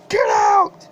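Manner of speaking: high sobbing yell; crying shout. Acted. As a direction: wail the order out. Driver screaming at passenger.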